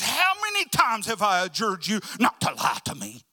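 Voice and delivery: loud, exasperated outburst; angry king. Acted. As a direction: demand it at the top of your temper. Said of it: "he gets mad".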